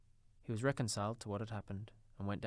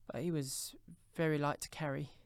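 higher, gentle mother's voice; mother resuming; 5 semitones higher than usual. A narrator's reading. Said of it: "she resumed".

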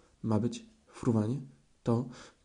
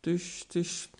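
higher, choking on soapy words; sputtering; 4 semitones higher, 3 dB louder, slower than usual. A narrator's reading. Piglet sputters accusation at Kanga after soap. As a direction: spit out the words through soap. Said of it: "wykrztusił prosiaczek".

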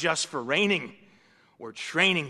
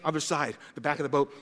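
usual speaking voice

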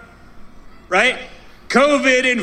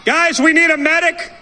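urgent call for a medic; man down shout; 2 semitones higher than usual. Real, not acted. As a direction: shout out to the crowd for help. Calling out for a medic in crowd.

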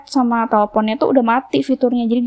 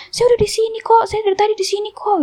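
very high mock-insistent voice; stubborn insistence, imitated; 11 semitones higher than usual. Driver insists she was there, imitated stubborn arguing. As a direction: imitate someone refusing to back down, high-pitched. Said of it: "Terus mbaknya juga ngeyel".

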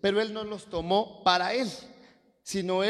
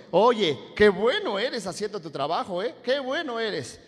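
admiring praise; 3 semitones higher, 4 dB louder than usual. Acted. Preacher voices someone praising a worker, impressed. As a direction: play up the amazement at someone's skill. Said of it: "Le han dicho".

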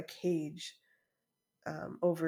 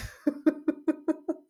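high laugh; 11 semitones higher, 6 dB louder than usual. Real, not acted. Laughs at staff advice.

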